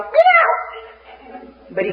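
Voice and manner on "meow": high yowling cat meow, much higher. Retold or imitated. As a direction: let out a shrieking cat yelp. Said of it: "he goes".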